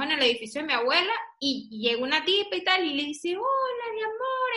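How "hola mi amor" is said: high, sweet stranger's hello; sugary greeting. Retold or imitated. Daughter imitates a stranger greeting her mother, high and sugary. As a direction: coo it like an overfriendly stranger.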